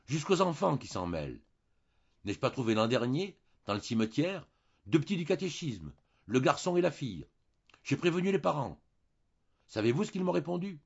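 a touch of scandalized outrage; indignant. Acted. Priest telling the baron about children, mildly indignant. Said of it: "d'un ton indigné".

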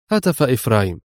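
usual speaking voice

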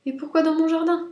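high, loud little rabbit voice; indignant, high-pitched question; protesting rabbit. A narrator's reading. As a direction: challenge the intruders in a high voice. Rabbit questions the intruding beasts.

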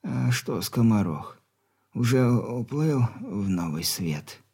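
weak stern dying father; very old man. Acted.

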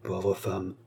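soft, hushed aside; murmured. A narrator's reading. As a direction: murmur it softly under your breath. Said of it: "murmura-t-il".

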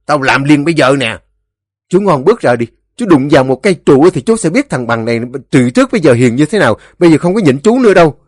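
loud, high, rapid-fire challenge; angry threats. A narrator's reading. Two neighbours dare each other over the fence, angry.

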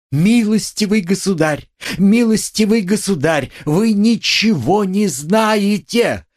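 frantic outburst; shouting. A narrator's reading. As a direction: cry out that he knows nothing. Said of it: "кричала Катерина Ивановна".

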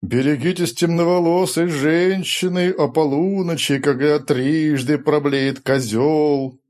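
slow, hammed-up prophecy chant; mock prophetic wail. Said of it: "вдохновенно пророчески заголосил Рори".

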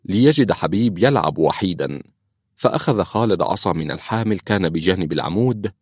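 usual speaking voice

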